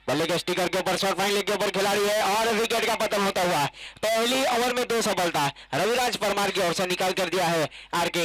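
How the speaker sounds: rising, thrilled call of a wicket; excited cricket commentary. Real, not acted. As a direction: lift the voice as the wicket falls. Wicket falls, commentator lifts.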